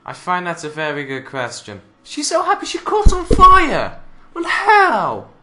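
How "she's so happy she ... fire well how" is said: high, loud shriek of disbelief; incredulous yell. Real, not acted. Commentator reacts to a pony on fire, shrieking in disbelief. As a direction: shriek it in baffled amazement.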